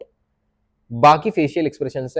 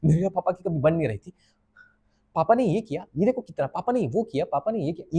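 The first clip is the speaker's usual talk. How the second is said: oversold, higher-pitched dramatic complaint; selling the emotions; 3 semitones higher, 5 dB quieter than usual. Shown as a demonstration. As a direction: act it for show, pushing each grievance outward. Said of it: "ये सेल कर रहा है emotions अपने".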